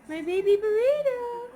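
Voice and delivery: high, rising coo. Real, not acted. Cooing at bundled baby.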